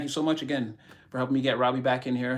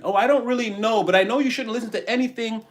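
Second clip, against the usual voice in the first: mock clueless doubter; 7 semitones higher, 4 dB louder than usual.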